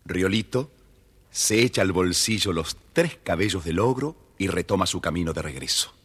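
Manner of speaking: plain reading voice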